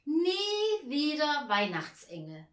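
loud, high, drawn-out declaration; emphatic wish. A narrator's reading. Angel telling the chief angel his wish, emphatic and slow. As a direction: stretch it out emphatically, starting high.

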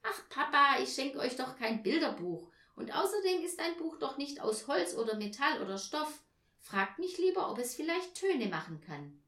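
lively girl, impatient with a bad guess; protesting little Tina. Tina rejecting Papa's book guess, protesting.

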